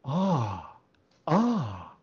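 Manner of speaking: soft, open opera ah; quiet opera singer. Shown as a demonstration. Coach demonstrating a quiet opera singer finding his keys. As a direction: sigh like a pleased opera singer, hushed. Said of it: "the opera singer finds his keys, but quietly".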